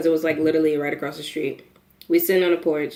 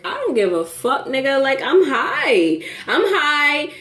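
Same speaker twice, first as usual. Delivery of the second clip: high-pitched, drawn-out insistence; defiant protest; 7 semitones higher than usual. Retold or imitated. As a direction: push back in a raised pitch. Retold quarrel with angry companion.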